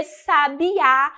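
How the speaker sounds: "sabiá" slow and loud on Á; final-syllable stress. Shown as a demonstration. Teacher marking the tonic syllable, "sabiá" stressed on Á. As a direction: lean hard on the last syllable of "sabiá". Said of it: "a sílaba tônica de sabiá é a última".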